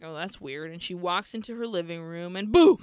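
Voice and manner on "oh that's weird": quiet, puzzled mutter; puzzled murmur. Retold or imitated. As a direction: murmur it as if something is off. Galvani hears the TV on.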